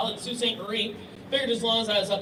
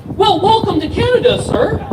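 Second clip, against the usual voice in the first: higher and louder, an exaggerated welcome; mock officer welcome; 10 semitones higher, 12 dB louder than usual. Canadian officer waving the florist through, loud mock welcome. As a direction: belt out a sarcastic greeting. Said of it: "He's like".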